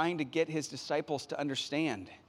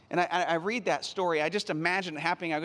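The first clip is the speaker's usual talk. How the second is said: ordinary delivery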